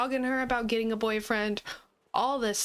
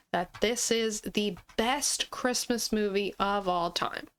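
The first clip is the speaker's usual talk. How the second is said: usual speaking voice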